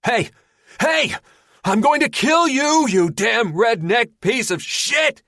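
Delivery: very high, very loud death threat; raging scream. Acted. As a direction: scream it through the closed door. Linder screams as door slams.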